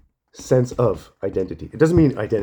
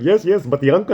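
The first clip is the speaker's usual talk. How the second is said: mock know-it-all; 6 semitones higher than usual. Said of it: "And somebody's like".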